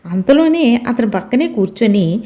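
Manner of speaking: usual speaking voice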